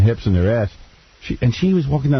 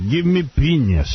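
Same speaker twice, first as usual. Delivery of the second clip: mock German accent; 4 semitones higher, slower than usual.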